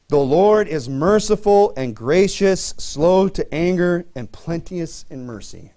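slow, solemn reading of the verse; measured scripture reading from the pulpit. Acted. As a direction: read it out like holy writ, slow. Reads scripture aloud.

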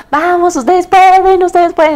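loud, high cheer from the stands; rallying shout to the men's team; cheering teammates on. Retold or imitated. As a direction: call out to push the runners on.

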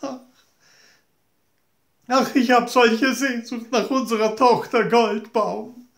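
hammed-up fake longing; fake sorrow. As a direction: play the grieving mother falsely. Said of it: "Sie stellte sich ganz traurig".

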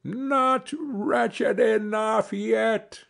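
high, very drawn-out taunt; relentless high-pitched sparrow retort. Acted. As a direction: stretch the words slowly in a high voice. Sparrow taunts the carter again.

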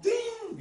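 high sung ding like a bell; microwave ding. Acted. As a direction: chime it like the microwave.

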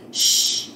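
sharp voiceless shush. Acted. Alex to the howling dog, shushing. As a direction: hush someone with a shh.

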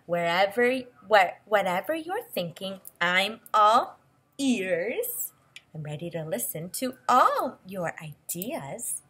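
lilting storybook read-aloud; expressive picture-book voice; reading to children. Acted. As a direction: read the book's lines to a little listener.

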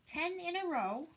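usual speaking voice

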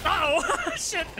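high-pitched cry breaking into laughter; laughing dismay. Real, not acted. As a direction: swear helplessly through a real laugh. Laughing as gameplay goes wrong.

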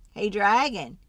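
ordinary delivery

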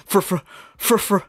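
"fr" sounded out as a blurted "fruh"; mocked misreading. Mocking the streamer's reading of the comment, "fr" said as "fruh". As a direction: repeat "fr" as stuttered "fruh" syllables. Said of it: "And you heard".

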